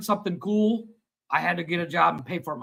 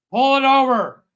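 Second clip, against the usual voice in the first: louder commanding cop order; cop's command; 6 semitones higher, 8 dB louder than usual. Retold or imitated. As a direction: bark it like a cop pulling you over. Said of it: "goes up".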